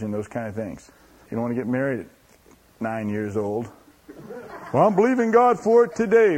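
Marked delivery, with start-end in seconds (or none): 4.7-6.4 a hammed-up naive believer, higher and louder with a laugh; preacher mocks someone demanding marriage now, in a hammed-up eager voice. Mocking a naive impatient believer.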